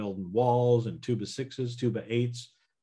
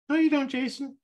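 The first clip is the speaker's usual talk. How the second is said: much higher, louder scoffing squeak; mock yourself in a tiny doubting voice; subconscious voice; 5 dB louder than usual. Shown as a demonstration. Coach voicing his doubting subconscious, squeaky and scoffing. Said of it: "use my little funny voices".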